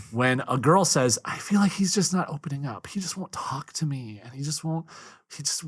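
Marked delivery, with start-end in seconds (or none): 1.2-5.6 high, soft put-on woman's voice, dropping to a whisper; a softer and gentler mock female voice; impersonating a woman. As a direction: imitate a hurt wife complaining gently. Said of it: "Is that your impersonation of women?".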